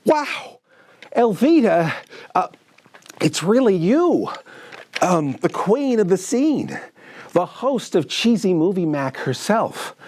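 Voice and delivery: high, awed fanboy gush; starstruck. Acted. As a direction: gush over your idol. Starstruck on hearing celebrity.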